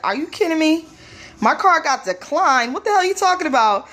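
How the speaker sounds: retold outrage, rushing the last question; incredulous. Shopper to cashier over a declined card, incredulous. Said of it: "I gave him that look like".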